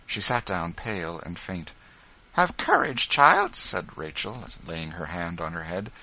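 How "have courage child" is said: high, louder heartening voice; earnest, high-pitched motherly urging; motherly encouragement. A narrator's reading. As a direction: hearten a frightened child. Rachel encourages fainting Eliza.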